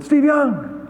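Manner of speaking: high called-out greeting; calling out a name. Retold or imitated. Airport fan calls out to him, high and eager. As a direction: call his name across the airport. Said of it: "someone said".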